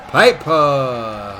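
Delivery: ordinary delivery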